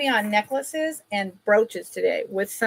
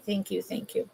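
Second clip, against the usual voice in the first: soft heartfelt thanks; 11 dB quieter than usual. Real, not acted. Thanking friends for a gift.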